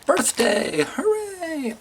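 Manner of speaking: squeaky-high falsetto cheer; drawn-out, high-pitched little hooray; mock celebration. Mock celebration of own birthday.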